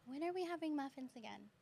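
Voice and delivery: put-on small, high, soft kid's question; imitating young student. Voicing a shy student asking.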